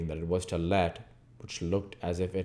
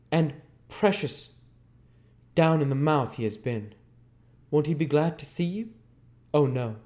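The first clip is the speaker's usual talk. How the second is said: higher, teasing voice; the voice in the dark teasing Sikes; sly unseen voice; 7 semitones higher, 3 dB louder than usual. Acted. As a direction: play the hidden doorkeeper slyly.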